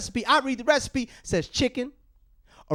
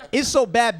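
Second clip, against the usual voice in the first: normal talking voice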